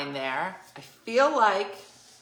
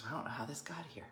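whispered mock innocence; 6 semitones lower, 16 dB quieter, much faster than usual. [name] feigning innocence.

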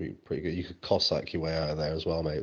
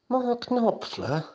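very high, slow, stunned squeal; incredulous squeal; 3 dB louder, much slower than usual. Acted. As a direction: squeak it out in disbelief. Repeated, even higher.

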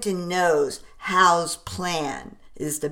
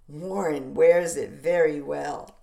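plain reading voice

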